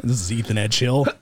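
low, put-on morning phone greeting; deep, formal mock-professional greeting; just-woken phone voice. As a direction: answer a call as if barely out of bed. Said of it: "I had woken up at eight oh five".

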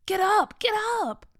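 high, loud urging; insist, pitching it up sharply; insistent. A narrator's reading. Sister waking her hungry brother, insistent. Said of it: "she insisted".

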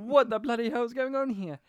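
a light take on an indignant English actor; lightly voiced British actor, exasperated; John Hurt impression. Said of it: "you're John Hurt".

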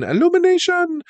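very high, squeaky put-on voice; high-pitched mimicry. Host echoes the studio's name, high squeaky mimicry. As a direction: squeal out ILLUMINATION far above your own pitch.

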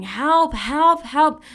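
retold scream for help. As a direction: call out for help in a half-scream. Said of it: "I was screaming".

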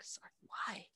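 soft unvoiced whisper; mock secretive whisper. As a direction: whisper the question back. [name] whispering back.

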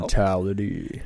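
low game announcer voice; deep announcer voice. Retold or imitated. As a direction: growl the word like a fight announcer. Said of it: "what's the Mortal Kombat sound?".

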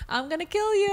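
high cheerful doll voice; sing-song toy doll threat; pull string toy. Retold or imitated. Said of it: "He's like".

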